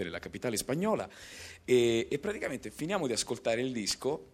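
ordinary delivery

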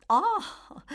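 sudden high, loud "Ah!"; a startled, knowing exclamation; high bright gasp of an old lady; surprised ah. Acted. Housekeeper exclaims at the portrait.

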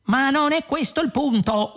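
high, exasperated yell; shouted. A narrator's reading. Ford protesting to the calm voice, shouted. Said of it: "urlò Ford".

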